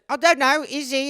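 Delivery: put-on high little kid voice; four-year-old child. Little boy presses mother about Santa, childlike. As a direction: wonder aloud like a small child. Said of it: "four years old".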